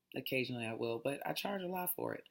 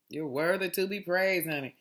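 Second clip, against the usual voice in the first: praising voice; 4 semitones higher, 6 dB louder than usual. Retold or imitated. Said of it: "It truly says".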